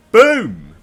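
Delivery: high, loud one-word burst; triumphant shout. Acted. Fan celebrating the crew moment, triumphant shout. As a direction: punch it out as a hammed-up victory shout.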